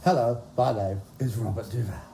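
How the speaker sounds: the same low put-on film star voice; Robert Duvall impression. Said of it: "do an impression of each of them".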